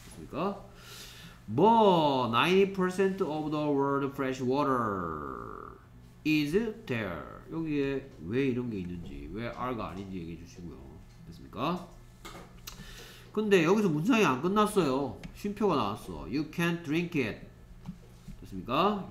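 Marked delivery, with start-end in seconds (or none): 1.5-7.2 very slow, word by word; slow read-aloud. Shown as a demonstration. Teacher reading the rain sentence slowly to students. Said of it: "teacher reads sentence". 16.2-17.5 very slow, word by word; slow read-aloud. Shown as a demonstration. Teacher reading the rain sentence slowly to students. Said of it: "teacher reads sentence".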